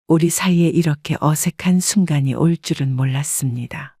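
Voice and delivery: ordinary delivery, nothing marked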